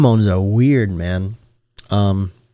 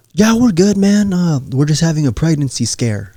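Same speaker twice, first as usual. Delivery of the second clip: high-pitched casual bro impression; imitated upbeat reassurance; breezy gym bro; 6 semitones higher than usual. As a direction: play a bro brushing off bad news, pitched up. Gym bro brushing off trouble.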